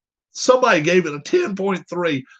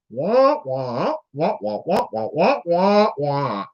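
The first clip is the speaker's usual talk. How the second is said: Charlie Brown's teacher; slower than usual. Retold or imitated. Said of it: "That's the way it sounded".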